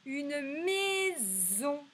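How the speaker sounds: "Une maison" slow and high, with a long buzzed z; audible Z sound. Shown as a demonstration. Teacher testing for the Z, "maison" drawn out. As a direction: stretch "maison" so the z rings out. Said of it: "on entend le son Z".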